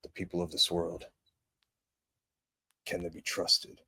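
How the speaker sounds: a somber father weighing humanity; brooding movie dad. Acted. Parody of Superman's dad speech.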